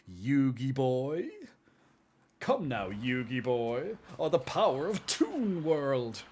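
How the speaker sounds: Pegasus voice. Retold or imitated. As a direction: purr it out like a smug villain. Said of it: "He's like".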